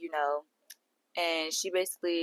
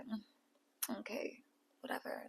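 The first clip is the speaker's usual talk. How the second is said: much softer, brushed-off okay with a smile; dismissive smirk; 19 dB quieter, slower than usual. Retold or imitated. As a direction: wave her off with a little smile. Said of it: "with a little smile".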